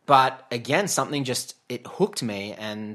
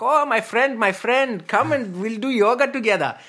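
much higher, louder mock friendly Indian man; imitating Indian friend; 13 semitones higher, 10 dB louder than usual. Man on the Udaipur street greets him, high loud mock voice. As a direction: call out "my friend" in a high, gushing impression.

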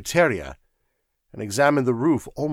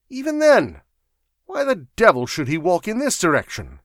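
raised, emphatic disbelieving protest; exasperated argument; 4 semitones higher, 7 dB louder than usual. A narrator's reading. Sir Walter objecting to Fisher's claim, exasperated. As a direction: argue it out, incredulous.

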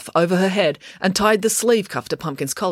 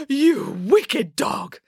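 high, very slow melodramatic scolding; outraged accusation; 8 semitones higher, much slower than usual. A narrator's reading. Woman spots the dog to blame, outraged accusation. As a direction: stretch each word in horrified outrage.

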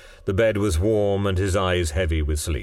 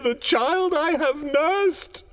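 put-on slow, high impassioned old woman; desperate mother's defiant wail; anguished cry; slower than usual. A narrator's reading. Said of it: "cried the old woman".

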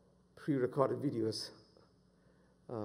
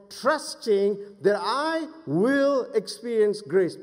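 high, loud, emphatic preaching; full-force preaching; 10 semitones higher, 8 dB louder than usual. Real, not acted. Preacher urging church to trust God's promise, full-force preaching. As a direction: drive each phrase home at raised pitch.

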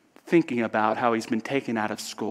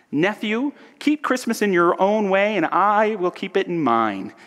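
raised, high-pitched insistence; irritated; 7 semitones higher, 4 dB louder than usual. Acted. Scrooge telling his nephew off over Christmas, irritated. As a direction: push back at him, pitch up.

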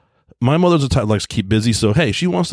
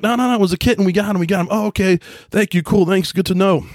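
high, hurried retelling of calling out no no no; a high flustered call, half laughing at the mix-up; frantic waving off; 8 semitones higher than usual. Retold or imitated. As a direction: wave the ambulance crew off in a flustered rush. Waving off arriving ambulance crew.

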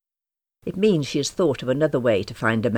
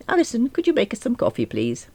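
brisk request; 11 semitones higher than usual. A narrator's reading. Boss asking for coffee.

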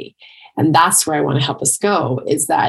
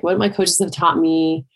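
ordinary delivery

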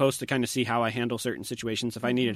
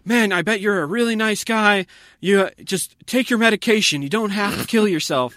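high, raised pleading voice; imitated yelling; 9 semitones higher, 5 dB louder than usual. Drunk guy grabs blind man's shoulder, imitated loud pleading. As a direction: plead loudly, mimicking a pushy stranger. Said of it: "this guy just starts yelling at me".

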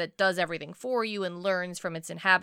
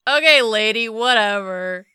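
dismissive; 8 dB louder than usual. Retold or imitated. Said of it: "he's like".

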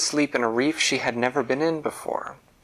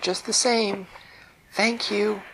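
higher thankful voice; grateful fish; 10 semitones higher than usual. Acted. Fish thanking the fairy, higher. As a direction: insist on thanks. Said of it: "And Ramuna said".

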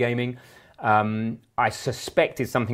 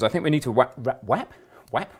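ordinary delivery

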